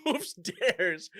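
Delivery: cracking up while echoing the line; laughing. Real, not acted.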